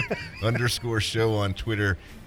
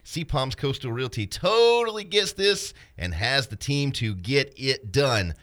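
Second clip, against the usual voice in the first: upbeat, higher sponsor-read voice; 6 semitones higher than usual. Acted. Co-host reading the sponsor ad in an upbeat ad voice. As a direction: sell it like a radio spot.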